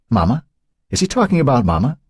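put-on high childlike asking. A narrator's reading. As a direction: ask like a little girl. Childlike longing, rising.

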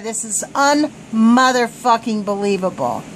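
usual speaking voice

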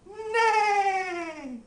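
high, loud whinny; horse neigh. Pretend. Horse sound.

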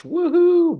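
a high, loud woohoo; reading a viewer's cheer with real excitement; chat cheer read aloud. Real, not acted. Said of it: "everybody's cheering in the chat".

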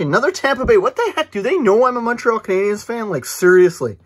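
high, loud outburst of disbelief; exasperated protest. Real, not acted. Rival team's card, protests in exasperation.

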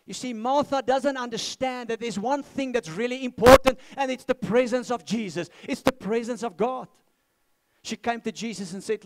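loud, high preaching at full force; fiery preaching. Acted. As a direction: preach it out with fire.

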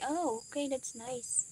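ordinary talk, nothing marked

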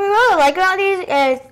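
speaking between bites; talking while chewing. Real, not acted. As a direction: mouth partly full of chocolate. Seen 0: girl bites chocolate at 0–1.8s; speaker uncertain, likely girl.

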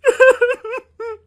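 high loud mocking cackle; ghostly cackle. Ghost mocks the couple with a loud high cackle. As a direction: cackle over their fear.

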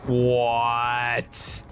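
low, long-held monotone words; stunned disbelief. Real, not acted. Gamer stunned by a miss, drawn-out disbelief. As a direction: drag each word out, low and level.

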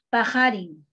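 "pajarin" dicho pa-ja-rin, la K como jota suave; pajarin con jota. Shown as a demonstration. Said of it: "se va a pronunciar pajarin". ("pajarin" pronunciado con jota, como en jarra)

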